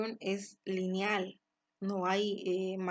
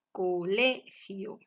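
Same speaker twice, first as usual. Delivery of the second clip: colegio slowly, LE pushed louder; tonic syllable stressed; 5 dB louder, much slower than usual. Shown as a demonstration. Teacher showing the tonic syllable, slow colegio with stressed LE. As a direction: spell out colegio by syllables, hitting LE. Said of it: "la mayor intensidad de voz".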